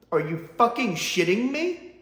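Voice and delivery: rising disbelief, said straight; first exasperated take. Shown as a demonstration. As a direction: blurt it out once, rising at the end. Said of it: "but I have to here".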